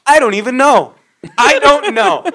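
high, loud, helpless outburst; exasperated. Retold or imitated. Worker asked what customers need, exasperated retold outburst. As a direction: throw up your hands vocally, pitched high.

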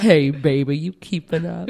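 flirty mock-Southern come-on; hammed-up sexy Southern voice, big on the opening; sexualized Southern accent. Shown as a demonstration. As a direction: purr it in a put-on Southern accent. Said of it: "sexualized version".

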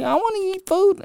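very high mock whine; squeaky put-on sibling complaint; pissed sister. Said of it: "my sister was pissed".

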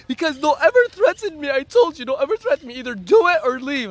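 high, heated retold ultimatum; angry warning. Acted quarrel, warning.

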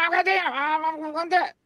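high, resigned grumble; exasperated giving in. Retold or imitated. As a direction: concede with a groan. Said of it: "And I'm like".

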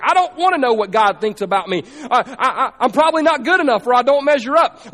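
preacher voicing insecure teen, hammed-up. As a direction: play a doubting teen with a nervous laugh. Voices insecure teenage listener.

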